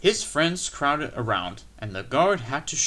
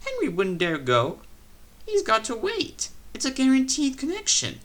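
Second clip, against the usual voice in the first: amused refreshment lady; 13 semitones higher, 4 dB louder than usual. A narrator's reading. Lady laughing at engine.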